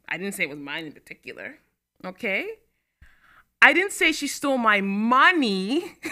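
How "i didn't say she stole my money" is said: money rising high and louder; money pushed up high, slow; stress on MONEY. Shown as a demonstration. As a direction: land hard on the word money. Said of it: "which means she stole stuff".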